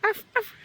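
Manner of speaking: high playful arf arf; mock dog bark. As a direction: bark like a cartoon puppy for the baby. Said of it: "the dog says".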